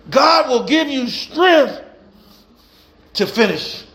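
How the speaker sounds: rhythmic preacher cadence, building; preaching. Acted. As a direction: preach it to the congregation with fire. Preaching to congregation.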